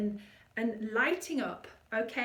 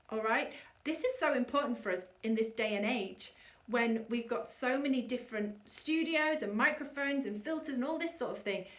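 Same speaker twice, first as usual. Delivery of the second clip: usual speaking voice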